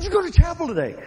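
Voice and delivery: high, loud, hammed-up snarl with a laugh; mock angry challenge. Football player challenging the receiver in fake anger. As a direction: bark the question at him, half laughing.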